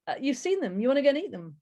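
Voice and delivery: normal talking voice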